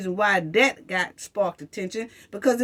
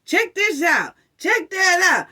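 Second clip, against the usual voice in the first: much higher, eager hammed-up pitch; mock a begging candidate; higher, hammed-up campaign voice; mocking politicians; 8 semitones higher than usual. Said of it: "you know what I'ma say".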